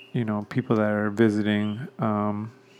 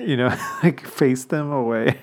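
real chuckle breaking up the words, pitched a little up; can't help laughing mid-sentence; laughing while talking; 2 semitones higher than usual. Real, not acted. Runner recalling a dad shielding his family, laughing. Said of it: "I shouldn't laugh".

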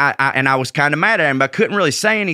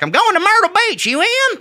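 much higher, fast squeaky buddy voice; Matt impression. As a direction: pitch way up and rattle it off. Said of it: "That's an impression of me".

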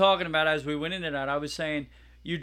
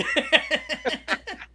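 high laugh; 4 dB louder than usual. Real, not acted. Laughing at own Ramsay bit.

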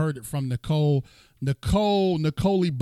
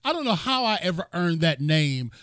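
ordinary talk

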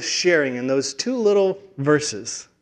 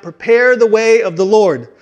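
louder, higher proclaiming call; crying out; 5 semitones higher, 7 dB louder than usual. Acted. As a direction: proclaim it like a voice in the wilderness. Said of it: "he's crying out".

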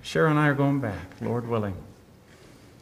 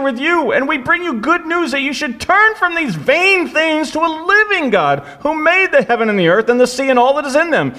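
loud, much higher plea as Paul; Paul crying out to stop the crowd; 9 semitones higher, 9 dB louder than usual. A narrator's reading. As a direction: cry it out to the crowd, high and strong. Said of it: "crying out".

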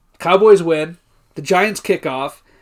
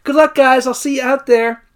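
breezy cheerleader; 7 semitones higher, 4 dB louder than usual. Retold or imitated. Acted cheerleader's breezy farewell.

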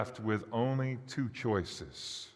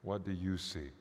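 usual speaking voice

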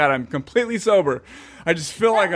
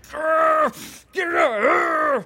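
high mock growl, shouting; comic pent-up fury; inner rage growl; 12 semitones higher, much slower than usual. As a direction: growl it out like a caged animal. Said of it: "inside you're like".

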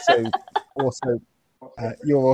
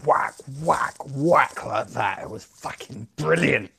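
over-the-top boasting; 3 semitones higher, 4 dB louder, slower than usual. Acted. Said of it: "over-the-top routine".

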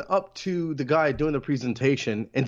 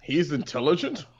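scoffing question breaking into mock laughter; incredulous, hammed-up ridicule. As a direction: imitate a crowd laughing at him. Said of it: "everyone laughs at him".

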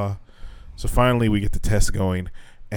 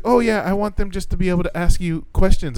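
mocking the teacher; 8 semitones higher than usual. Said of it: "She's like".

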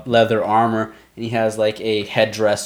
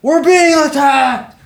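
much higher, louder, slow alarmed yell; orcs yelling; 5 dB louder, slower than usual. Acted. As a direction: bellow it across the camp. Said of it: "You hear the orcs begin to yell".

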